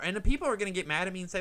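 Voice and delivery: ordinary talk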